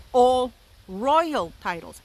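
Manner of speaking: slow, heavy weight on royal; stress on royal. Shown as a demonstration. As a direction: lean hard on the word royal. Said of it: "and I stress the word royal".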